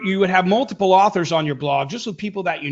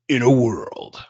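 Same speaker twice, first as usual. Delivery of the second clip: low, breathy movie-trailer announcer; deep movie voice; 6 semitones lower, slower than usual. Acted. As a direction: intone it like a trailer voice-over guy. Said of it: "the Deep movie voice guy".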